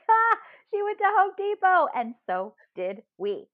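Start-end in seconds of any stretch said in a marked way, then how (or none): 0.1-1.9 much higher and louder triumphant glee; gleeful gotcha. Retold or imitated. Delighted the celebrity shopped there too, gleeful. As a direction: crow it like you caught her.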